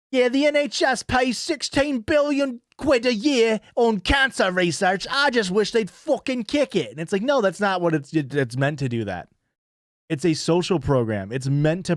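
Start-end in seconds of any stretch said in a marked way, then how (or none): 0.1-6.9 much higher, hammed-up grumbling bloke; mock British bloke. Streamer mocking anti-tax Brits, high hammed-up bloke voice. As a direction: whine it like a stingy taxpayer. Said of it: "you can't be like".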